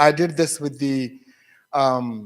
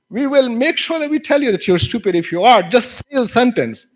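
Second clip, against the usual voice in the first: raised, loud classroom reassurance; teacher's firm prompting; 8 semitones higher, 5 dB louder than usual. Retold or imitated. Teacher prompting embarrassed student to read, loud and firm. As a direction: push the student briskly to get on with it.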